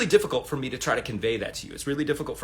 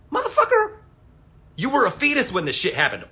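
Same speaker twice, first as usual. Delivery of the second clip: much higher, louder exasperated outburst; exasperated yell; 12 semitones higher, 9 dB louder than usual. Retold or imitated. Vlogger yelling at online kids pontificating, exasperated shout. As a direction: blast it at the know-it-all kid. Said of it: "When I'm like".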